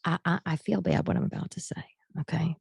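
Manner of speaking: whispered confession, guilty. Real, not acted. Whispering a guilty aside to the audience. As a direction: lean in and whisper it.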